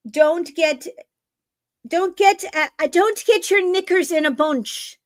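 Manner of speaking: a playful hint of Irish brogue telling the audience to calm down; Irish accent. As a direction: play a cheeky Irish scold in a light Irish accent. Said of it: "That's my Irish".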